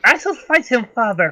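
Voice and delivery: a daughter eager to prove herself; girl voice. Acted. Half-elf girl telling her father she will fight, girlish. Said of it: "accent in that voice".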